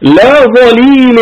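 ترتيل مرتفع; Quran recitation. Acted. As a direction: intone it slowly like a verse. Recites verse fragment.

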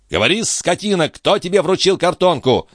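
insulting, insistent demand; demanding interrogation. A narrator's reading. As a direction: grill him impatiently for an answer. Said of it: "допытывался Жан у швейцара".

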